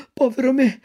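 high, dismayed cry of povero me; first lament. Acted. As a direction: start the wail, not yet full. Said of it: "gridò Pinocchio".

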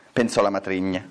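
ordinary delivery

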